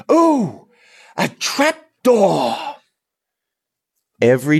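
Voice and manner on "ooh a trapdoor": hammed-up big line. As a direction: overblown high-pitched wail, slow.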